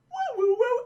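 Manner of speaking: high hooting chirp; little creature's high warble; imitated dinosaur call. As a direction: hoot like a small dinosaur.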